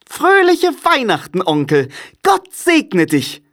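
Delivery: high-pitched, jolly nephew calling out; cheerful call. A narrator's reading. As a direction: sing out a merry Christmas greeting. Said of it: "rief eine fröhliche Stimme".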